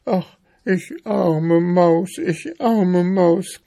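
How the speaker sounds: high, slow, self-pitying mouse; plaintive little mouse moan; lamenting. A narrator's reading. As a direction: wail pitifully in a drawn-out high voice. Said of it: "klagte Mariechen Knusperkorn und schluchzte".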